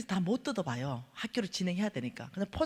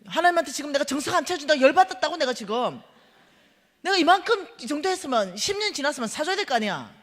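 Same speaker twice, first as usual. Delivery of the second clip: much higher, louder furious rant; angry rant; 5 semitones higher, 8 dB louder than usual. Retold or imitated. Ranting at her husband about God's question, angry. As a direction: let it all pour out. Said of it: "내가 막 이러니까".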